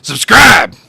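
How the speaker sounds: sudden gruff yell, very loud and high; gruff shouted subscribe. Shown as a demonstration. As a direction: bark it out like a chainsaw. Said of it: "in my grumbly, gruff voice".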